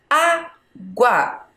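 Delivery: "Água" in two separate beats, Á, GUA; split into syllables. Shown as a demonstration. Teacher counting with a finger, "Água" syllable by syllable. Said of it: "Vamos lá com o dedo".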